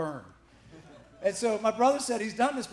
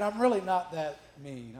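ordinary talk, nothing marked

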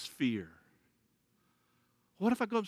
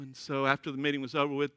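usual speaking voice

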